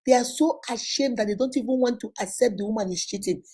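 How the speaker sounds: ordinary talk, nothing marked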